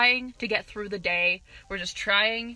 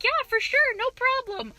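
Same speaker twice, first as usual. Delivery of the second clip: very high-pitched, eager customer-service voice; chirpy agreement; 13 semitones higher than usual. Retold or imitated. Cashier agreeing to a customer's bagging request, chirpy. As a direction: put on an obliging, cheery squeak.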